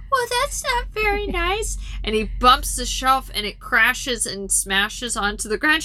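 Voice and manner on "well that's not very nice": high, put-on old lady scolding; imitated old lady voice; old woman. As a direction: chide mildly in an old woman's falsetto. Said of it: "and she's like".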